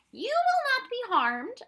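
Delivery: high, loud, slow character voice; slowly and firmly reassure a frightened girl; reassuring Proc. A narrator's reading. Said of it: "the Proc reassured her".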